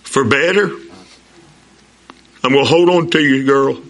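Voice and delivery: groom vowing to his bride, slow and solemn; solemn vows. Retold or imitated. Repeating the wedding vows.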